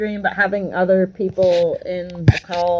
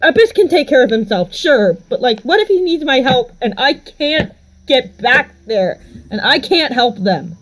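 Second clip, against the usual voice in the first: raised, urgent worrying in character; agitated, higher-pitched acted fretting; anguished worry; 4 semitones higher than usual. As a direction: spill out fears of failing to protect them. Character frets over protecting loved ones.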